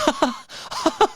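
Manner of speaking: high squeaky imitated laugh; mock high giggle. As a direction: giggle like a kid at a sitcom.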